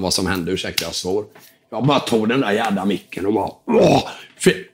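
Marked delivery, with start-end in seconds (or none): 3.7-4.1 high, sudden vocal burst from the belly; demonstrating a belly-powered yell; re-enacted roar. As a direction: let out a short mock scream. Re-enacts his roar into the mic.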